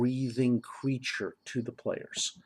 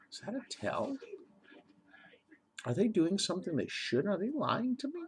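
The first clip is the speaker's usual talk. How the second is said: suspicious inner voice; 9 semitones higher, 5 dB quieter than usual. Retold or imitated. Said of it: "the characters can go".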